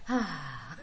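low, soft sigh of relief with a laugh; a pickled brain sighing happily; contented low ahh; relieved ahh. Retold or imitated. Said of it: "you're gonna hear".

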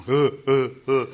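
loud, short mimicked sobs; imitated sobbing. Man retelling his tearful phone call, imitated loud sobs. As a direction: act out bawling in separate bursts. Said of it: "by then I'm just crying".

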